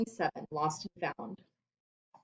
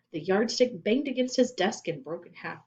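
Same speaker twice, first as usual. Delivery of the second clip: ordinary delivery, nothing marked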